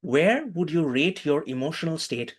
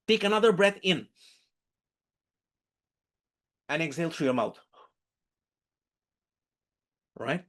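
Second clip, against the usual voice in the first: clear, raised coaching instructions; guided breathing; 3 semitones higher than usual. Acted. Teacher guiding the class through a breathing exercise.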